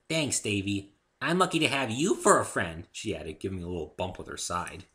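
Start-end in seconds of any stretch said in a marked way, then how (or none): 0.1-2.9 loud, bouncy fondness; affectionate Pinkie. Acted. Pinkie bumps Dave fondly, loud and bouncy. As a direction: nudge him playfully.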